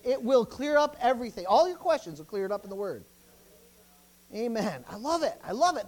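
loud, high, excited preacher; fired-up preaching. Real, not acted. Preaching at full force.

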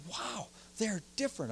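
high, hushed wow of wonder; excited discovery. Retold or imitated. Pastor reliving his discovery, high and hushed. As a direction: breathe it out in amazement. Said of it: "man, I was excited".

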